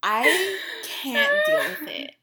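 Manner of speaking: high, slow laughing gush; real giddy laugh. Real, not acted. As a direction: laugh out the crush. Gushing over a crush.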